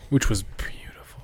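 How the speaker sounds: breathy whispered aside; whispering. Real, not acted. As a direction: whisper it admiringly.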